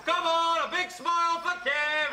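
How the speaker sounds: high, loud coaxing shout. Acted. Photographer urging an unwilling woman to smile, shouting. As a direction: holler it to coax a smile. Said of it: "Will you please stop shouting?".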